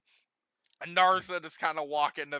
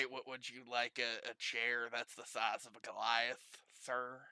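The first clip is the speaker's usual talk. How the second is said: begrudging; 6 semitones lower, 10 dB quieter than usual. Acted. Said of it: "they begrudgingly say".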